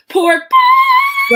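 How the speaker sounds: high, loud cry of pork butt; emphatic shout. Retold or imitated. Church lady proudly shouting about her pork butt. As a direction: belt it out, proud and insistent.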